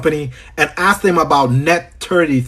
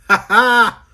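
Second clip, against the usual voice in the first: high, short happy laugh; delighted laugh; 7 semitones higher than usual. Real, not acted. Host laughing at family up in here, delighted. Laughs at his people showing up.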